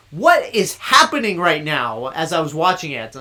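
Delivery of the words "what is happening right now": slow, louder mock-baffled outcry; retold bewilderment. Reviewer reliving his reaction to a psychedelic scene, mock bewilderment. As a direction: draw it out loudly, as if bewildered. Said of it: "that made me go".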